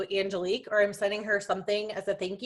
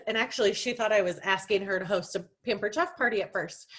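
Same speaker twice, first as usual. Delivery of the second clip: ordinary talk, nothing marked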